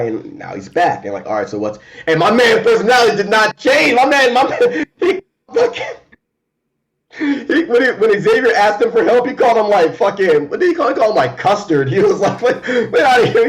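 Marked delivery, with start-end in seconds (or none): 2.1-12.4 high, loud, laughing rave; breathless fan excitement; excited gushing. Real, not acted. Gushing about Thunderbird's return. 12.4-13.5 laughing quote. Retold or imitated. Quoting the surly hero.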